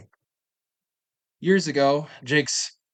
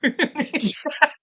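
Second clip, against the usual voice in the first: high cackling laugh; 12 semitones higher than usual. Real, not acted. Laughing at the prank.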